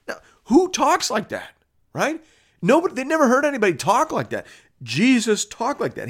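very high-pitched, incredulous preaching; impassioned amazement. Real, not acted. Preacher amazed that Jesus talked like that, impassioned. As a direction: marvel at it with pitch soaring.

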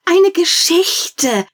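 put-on high, loud, slow child's cry; children shouting. Children begging the storyteller, loud high cry. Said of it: "riefen die Kinder".

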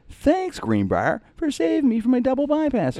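high, beaming thank-you voice, hammed up; joking about ending up as a grateful patient on the brochure, high gushing mock voice; gushing mock testimonial. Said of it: "me waving, going".

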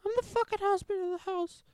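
high-voiced husband arguing with his wife; girly voice. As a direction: play the husband way up high like a girl. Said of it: "Why do you fucking sound like a girl?".

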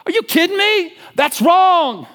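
high, loud furious shout; furious outburst. Retold or imitated. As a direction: explode with outrage at the injustice. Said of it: "David gets furious".

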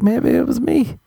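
high, doubtful woman's voice; mock woman voice. Women after a date wondering aloud, in a high mock voice. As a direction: play a puzzled woman wondering if it was her fault.

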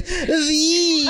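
V stretched out high; exaggerated emphasis. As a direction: draw out V in a high, overdone squeal. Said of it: "make sure you put the emphasis on it".

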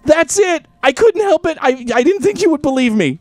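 high, loud, gleeful reveal; mischievous excited voice. Real, not acted. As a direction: show off the gross prize with glee. Gleefully showing the fingernails.

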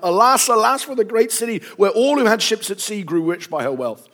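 high, loud declaimed lament; dramatic outcry of woe, read aloud; crying out. A narrator's reading. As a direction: raise a cry over the great city. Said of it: "crying out".

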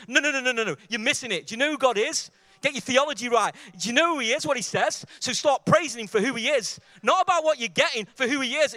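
loud, driving preacher's voice; impassioned preaching. Real, not acted. Preaches at full force.

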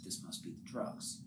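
breathless whisper to himself; whispered aside. Acted. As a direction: hush it like a dazed thought. Grimace muttering while high.

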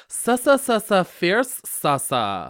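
camp it up, hissing sa sa sa. Acted.

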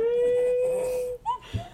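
long, high-pitched cackle of real amusement; high squealing laugh. Real, not acted. Group cracks up at vampire joke.

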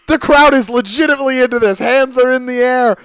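loud, high, thrilled calling; hyped commentary. Real, not acted. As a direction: sell the crowd's energy.

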